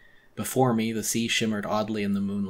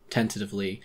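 ordinary delivery, nothing marked

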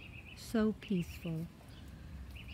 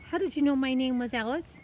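put-on quick, higher girl's question; puzzled girl; 5 semitones higher, 5 dB louder, faster than usual. Girl asks how cat knows her name, puzzled. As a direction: ask rapidly in surprise, pitched up.

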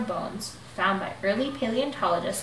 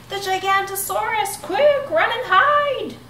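frantic cry; 11 semitones higher, 5 dB louder than usual. Acted. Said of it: "Bonehead cried".

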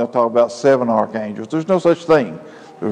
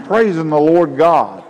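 ordinary talk, nothing marked

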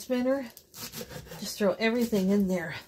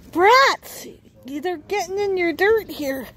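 loud high scolding yell; shouting; 9 semitones higher, 7 dB louder, slower than usual. Real, not acted. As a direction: shout at the brats. Scolding animals in the dirt.

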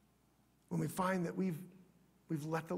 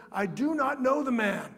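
louder, higher emphatic denial; raised voice, insisting on innocence; sworn denial; 7 semitones higher, 6 dB louder than usual. Acted. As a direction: swear it out forcefully. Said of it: "He swore and he said".

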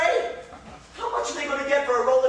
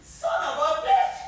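shrieked far higher; raging scream. Acted. Husband screaming out the window at the thieves. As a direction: let it rip from the gut.

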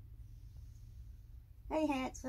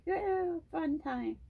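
high, excited voice for the snake; voicing the snake; 12 semitones higher, slower than usual. Owner voicing her excited snake in a high squeal. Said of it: "He's like".

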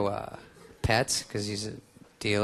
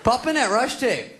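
high rowdy mom voice; 13 semitones higher, 5 dB louder than usual. Acted. Hamming the mom.

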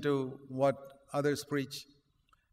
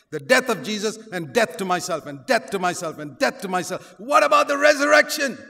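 loud rising preacher's cry, pressing the point; full-force preaching; 3 semitones higher, 6 dB louder than usual. Acted. Preacher rousing the congregation, loud and rising. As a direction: preach it hard, building up.

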